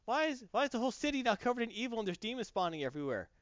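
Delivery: high excited cheer turning confused; cheer, then sudden confusion; mock cheering. Acted game characters cheering, then confused.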